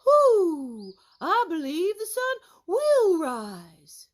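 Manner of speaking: loud hooting owl, slow; a wise owl hooting, then speaking; owl's hoot. Acted.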